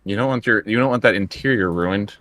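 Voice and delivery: usual speaking voice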